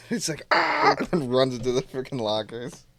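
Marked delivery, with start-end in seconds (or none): 0.5-1.0 high startled shriek; much higher hammed-up scream; Buddy startled by the singer; mock scream.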